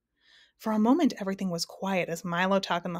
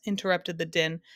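usual speaking voice

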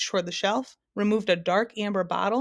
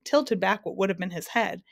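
ordinary delivery

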